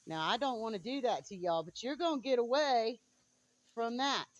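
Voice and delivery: loud, high warning; scolding the chickens. Real, not acted. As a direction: warn them off loudly.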